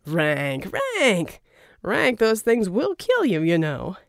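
teasing sing-song calls; mock scolding, drawn out slowly; playful greeting. A narrator's reading. Verdigris teasing Ranker about his cigars.

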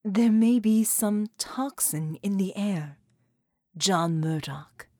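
slow, carefully measured formal voice; slow formal speech. A narrator's reading. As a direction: speak each word deliberately and formally. Sarah speaks, slow formal voice.

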